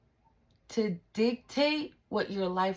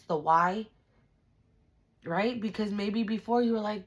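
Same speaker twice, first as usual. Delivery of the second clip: normal talking voice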